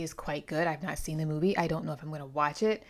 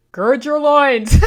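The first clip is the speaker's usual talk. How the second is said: loud, high, slow declaration; hammed-up loud battle cry; dramatic proclamation; 8 semitones higher, 16 dB louder, slower than usual. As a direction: proclaim it grandly. Stumbling then belting the quote.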